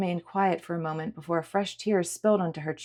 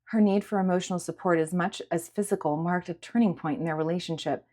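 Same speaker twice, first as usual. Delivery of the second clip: ordinary delivery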